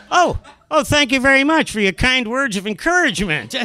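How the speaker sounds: high-pitched mock gratitude; sarcastic thanks. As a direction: thank the heckler with acted sarcasm. Acted sarcastic retort to heckler.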